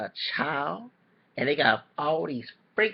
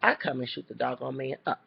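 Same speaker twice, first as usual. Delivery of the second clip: ordinary delivery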